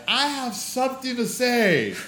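put-on slow, high, solemn drunken declaration; mock-grand drunk proclamation; drunk announcement. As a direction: announce it like a drunk making a big speech.

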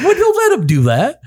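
high protest through a laugh. Retold or imitated. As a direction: laugh while half-heartedly protesting. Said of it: "I was like".

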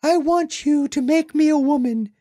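put-on high coy girlish voice. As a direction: bat your lashes and coo it. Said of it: "what if she had said".

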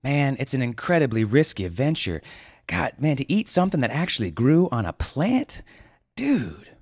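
high surfer voice, shocked; high incredulous dude; aghast dude. Acted. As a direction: marvel at the danger. Julian aghast.

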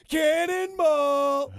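drawn-out, high cannonball call; cannonball yell. Acted. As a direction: stretch it out like jumping off. Shouting the jump call.

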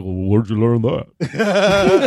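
slow, lightly voiced Stallone; drawn-out hint of Stallone; mock Stallone. Imitating Stallone asking the kid, slow mock voice. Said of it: "Stallone's like".

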